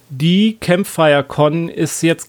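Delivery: usual speaking voice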